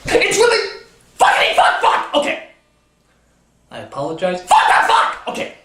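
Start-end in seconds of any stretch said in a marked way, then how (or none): 0.1-2.1 frustrated swearing at the screen; angry outburst. Real, not acted. Raging at the movie's title. 4.4-5.3 bursts of frustrated cursing; angry swearing. Real, not acted. Swearing at the movie again.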